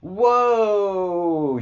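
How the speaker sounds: a big rising gasp of delight, loud and high; surprised whoa. Real, not acted. Streamer spots a fan's Q shirt, excited whoa. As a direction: caught off guard and thrilled.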